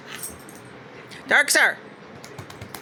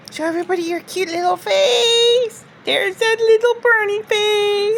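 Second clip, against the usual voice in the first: talking to a dog; 10 semitones higher than usual. Real, not acted. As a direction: coo up high at the puppy. Cooing at the dog.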